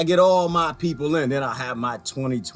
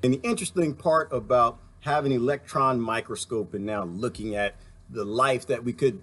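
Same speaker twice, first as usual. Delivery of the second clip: ordinary talk, nothing marked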